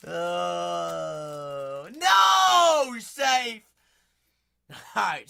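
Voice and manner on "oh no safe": hammed-up shout at a friend; mock dismay shout. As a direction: cry out in hammed-up horror.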